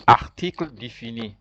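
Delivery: Article défini in careful French, ar-teekl day-fee-nee; model French phrase. Shown as a demonstration. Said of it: "repeat after me".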